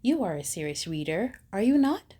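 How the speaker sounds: ordinary delivery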